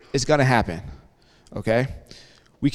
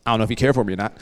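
usual speaking voice

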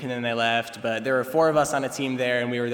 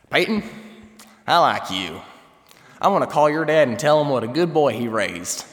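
Southern twang; 5 dB louder than usual. Retold or imitated. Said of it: "He talked with an awesome Southern twang".

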